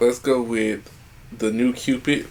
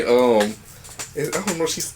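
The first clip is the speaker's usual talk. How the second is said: ordinary talk, nothing marked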